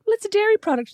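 high, indignant quip; retelling Holly justifying salad cream; mock defensive host. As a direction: squeak it out as a defence. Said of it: "she's like".

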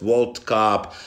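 ordinary talk, nothing marked

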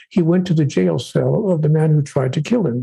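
ordinary delivery

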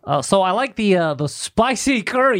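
chummy small talk in a mock Canadian accent; folksy Canadian-guy impression with stretched vowels; western Canadian accent. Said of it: "they kinda all sound like that".

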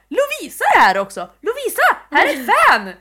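high, loud calling across the shop; excited calling out. Retold or imitated. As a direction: call your friend over across the shop. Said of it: "Jag bara".

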